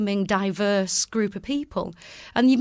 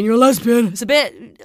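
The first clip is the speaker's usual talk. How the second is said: coarse Manchester accent, much louder; Mancunian accent; 3 semitones higher, 11 dB louder than usual. Shown as a demonstration. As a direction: bark it out like a Manc. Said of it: "if you're Mancunian".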